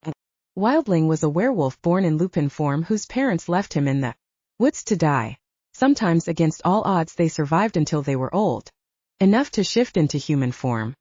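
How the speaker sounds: plain reading voice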